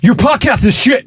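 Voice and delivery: high, slow mock American accent. Podcaster imitating online haters of the show, mock American accent. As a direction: imitate an American heckler, pitched well up. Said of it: "It's a lot of Americans".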